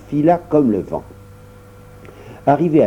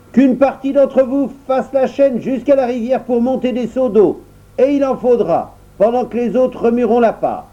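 much higher, brisk orders; the baker orders the dwarves about, much higher; commanding baker; 11 semitones higher than usual. Acted. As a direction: direct the crowd of dwarves.

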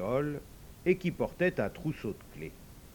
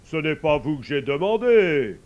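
loud, gruff pope brushing him off; angry pope; 2 semitones higher, 10 dB louder than usual. Acted. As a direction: send him away, annoyed. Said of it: "d'un air fâché".

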